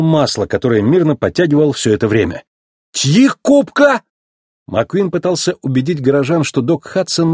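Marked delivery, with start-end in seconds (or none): 2.9-4.0 very high, slow incredulous echo; squeaky, drawn-out disbelief; astonished. A narrator's reading. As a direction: gasp the question out in amazement. Stunned echo after spitting out oil.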